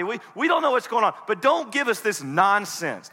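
acted exasperated protest; indignant disbelief. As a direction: scold them, refusing to believe. Disciples scornfully reject the women's news.

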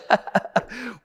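short put-on jeering laugh; mocking laugh. Father over his hurt boy, mock laughing. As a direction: pretend to laugh at their pain.

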